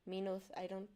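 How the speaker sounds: usual speaking voice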